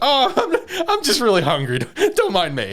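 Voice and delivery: high, laughing, caught-out excuse; flustered laughing excuse. Retold or imitated. Shinpei caught with two plates, nervous high laughing excuse.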